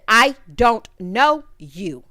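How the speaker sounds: drawn-out, deadpan dismissal; slow icy I don't know you; slow, cold brush-off. Retold or imitated. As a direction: stretch each word like a shut door.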